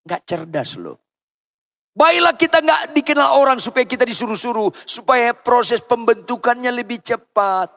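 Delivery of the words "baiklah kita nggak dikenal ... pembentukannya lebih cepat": high, ringing preacher's exhortation; full-force preaching. Real, not acted. Preacher urging the congregation to accept being ordered around, exhorting. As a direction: preach it out high, stretching the ending.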